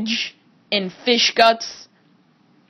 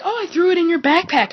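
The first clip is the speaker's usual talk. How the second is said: breezy mock friend; 8 semitones higher, faster than usual. Friend's careless confession, retold.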